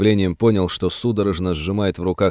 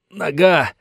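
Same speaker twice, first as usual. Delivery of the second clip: strangled rasp; 8 semitones higher than usual. Acted. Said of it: "сдавленно прохрипел раненый".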